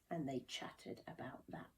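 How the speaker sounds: soft unvoiced whisper; whispered narration. A narrator's reading. Narrator whispering of friends chattering by the river. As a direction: breathe the words like gossip.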